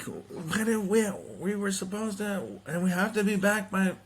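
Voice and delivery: a mildly voiced mother in a panic; flustered mom.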